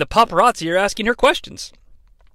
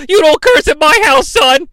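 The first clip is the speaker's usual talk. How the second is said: high loud mock scolding; Russo scolding; 8 semitones higher, 5 dB louder than usual. Retelling Russo scolding Reed, high mock yell. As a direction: scold like an outraged dad. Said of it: "Russo goes".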